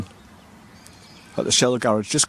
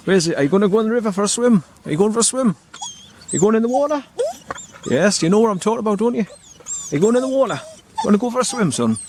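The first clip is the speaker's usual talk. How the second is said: much higher, sing-song dog voice; playful pet talk; 9 semitones higher than usual. Real, not acted. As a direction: owner teasing the dog about a swim. Teasing the dog about swimming.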